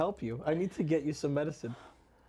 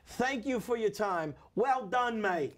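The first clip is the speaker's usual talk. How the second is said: put-on English accent; 2 semitones higher than usual. Front desk scolding a late caller, English accent. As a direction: ham up a Cockney-ish front desk. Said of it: "I love doing the English accent".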